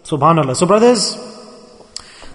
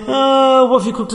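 higher, whiny complaint about dinner; mock moaning; 7 semitones higher than usual. Husband moans at his wife about dinner, mock whine. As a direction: imitate a grumbling husband, half-hammed. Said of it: "he starts moaning".

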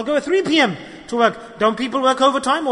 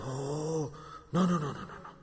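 mock whining no, much softer and lower; whiny refusal; 6 semitones lower, 15 dB quieter than usual. Preacher mocking a brother told to pray Fajr, softly whining. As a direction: moan like a lazy man pushing it away.

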